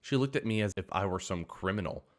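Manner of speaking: plain reading voice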